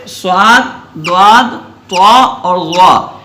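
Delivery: slow صاد، ضاد، طا اور غا, each thick and rounded; heavy letters. Shown as a demonstration. Teacher demonstrating heavy letters, slow and full-mouthed. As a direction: raise the tongue root on صاد، ضاد، طا اور غا, drawing each out. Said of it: "زبان کی جڑ کو اوپر اٹھانا".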